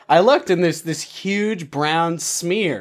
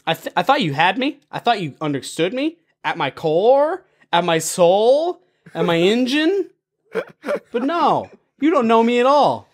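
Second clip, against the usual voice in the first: mock wounded drama; 8 semitones higher than usual. Mock hurt rant to match.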